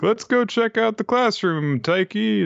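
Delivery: very high put-on classmate voice; acted classmates. Classmates calling Taiki to the classroom, mock high voice. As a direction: pitch it way up as an eager friend.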